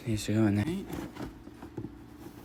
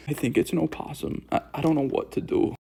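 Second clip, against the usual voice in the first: ordinary talk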